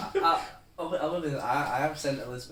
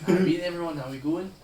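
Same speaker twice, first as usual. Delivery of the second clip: put-on loud, higher Newcastle accent; Geordie accent; 5 semitones higher, 8 dB louder than usual. Friend showing off his Geordie, loud Newcastle accent. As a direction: greet everyone in broad Geordie. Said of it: "So this is my Geordie".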